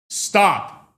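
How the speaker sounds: a loud, commanding Stop; sharp stop. Shown as a demonstration. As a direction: snap out the word Stop as a command. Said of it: "I could say the same thing and it can mean two different things".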